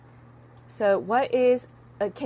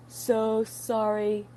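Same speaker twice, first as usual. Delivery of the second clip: drawn-out, very slow apology; exaggeratedly slow delivery; slowed right down; much slower than usual. Shown as a demonstration. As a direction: stretch each word out slowly. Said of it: "Oh, too fast".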